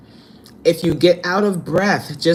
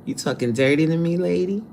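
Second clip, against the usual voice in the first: ordinary delivery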